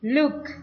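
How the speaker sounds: "Look" said as "luk", high and loud; U vowel. Shown as a demonstration. Teacher shows how it is read, "Look" with U. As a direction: give "Look" a U sound. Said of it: "Ini dibacanya look ya, pakai U".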